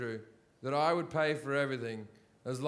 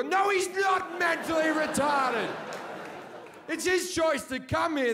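comic panic, voice cracking up high; panicked retold shout; 10 semitones higher than usual. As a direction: blurt it out in a high alarmed yell. Panicked denial to the hooker.

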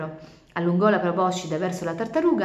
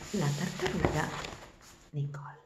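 title announcement; 9 dB quieter than usual. A narrator's reading. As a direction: announce the title, then breathe the name. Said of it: "title read out". (annuncio del titolo, sussurrando il nome)

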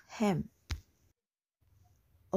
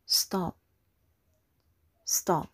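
"stop" short, pitch dropping; falling tone. Shown as a demonstration. Teacher's English example of third tone, "stop" falling. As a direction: let "stop" fall firmly. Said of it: "The third tone is a falling tone".

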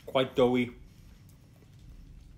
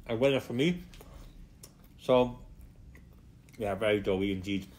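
words muffled by food; talking while chewing; 3 semitones lower, 4 dB quieter than usual. Real, not acted. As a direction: mouth full of pizza. Seen 2: Bites pizza at 0.6, then visibly chews while talking through 6.6.

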